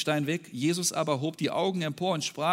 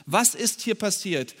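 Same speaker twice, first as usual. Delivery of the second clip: normal talking voice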